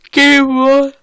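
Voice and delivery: words stretched through a big yawn. Real, not acted. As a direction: say it mid-yawn, high and loud. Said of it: "Sorry for yawning".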